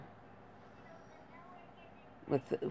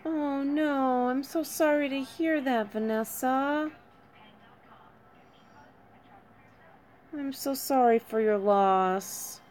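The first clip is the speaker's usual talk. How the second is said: heartfelt sympathy; 8 semitones higher than usual. Real, not acted. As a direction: console someone grieving in a high, gentle voice. Consoling a bereaved viewer.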